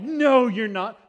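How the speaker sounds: mock yell of doubting second graders; hammed-up, high-pitched kid shout; kids' loud denial. Kids shouting in disbelief.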